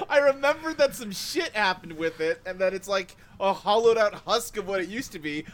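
ordinary talk, nothing marked

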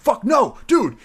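loud, high monkey yell; angry monkeys. Retold or imitated. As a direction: yell to stop the new monkey. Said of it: "the rest of the monkeys are like".

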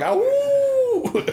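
high falsetto howl; howling like a wolf. Pretend. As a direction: throw your head back and howl. Howl after "Three dog!.

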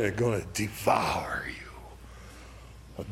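hushed menacing whisper; ominous whisper. Acted. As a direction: drop to a breathy whisper as a warning. Warning of sin, hushed.

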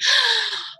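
sharp gasp of sudden remembering; quick high startled intake of breath. Retold or imitated. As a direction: gasp as if it just hit you. Waking with sudden memory.

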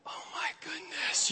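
breath-only stunned whisper; hushed, acted amazement; awestruck whisper. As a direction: whisper it as if overwhelmed. Retold awestruck inner reaction.

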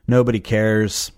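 ordinary talk

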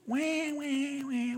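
soft imitated whining, high at first; mock baby wail. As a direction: whine like a fussy baby. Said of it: "but with Christians".

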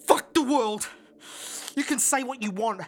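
loud, high, explosive tirade; furious cursing. Acted. Cursing everyone after pressure builds.

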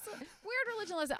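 high-pitched, giggly mock quote; teasing mimicry. Teasing the co-host about the lesson, high mock voice. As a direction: mimic them in a high, rushed voice.

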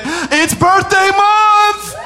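high, slow, hammed-up announcing voice; mocking someone who celebrates a whole birthday month; mock birthday braggart. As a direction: ham it up like a show-off birthday girl.

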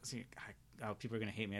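whispered under her breath; a quick whisper to herself. Real, not acted. Catches her own slip.

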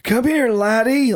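mock an old American grandpa, drawn out; old American guy. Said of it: "if he's like an old American guy, he's like".

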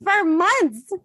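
high, far-off incredulous rant; exasperated outburst. Retold or imitated. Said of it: "And I'm like".

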